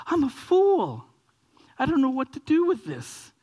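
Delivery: high, overwhelmed wail; mock laughing-crying. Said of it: "you're laughing at the same time".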